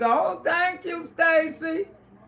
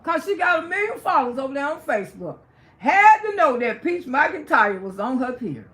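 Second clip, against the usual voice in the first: usual speaking voice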